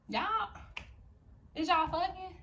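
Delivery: high, disbelieving question; incredulous. Real, not acted. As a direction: ask in mock disbelief.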